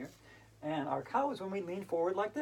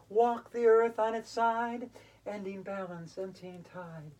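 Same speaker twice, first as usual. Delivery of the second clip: slow fading refrain; 2 semitones higher, 4 dB louder, slower than usual. Acted. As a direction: let the line sink away.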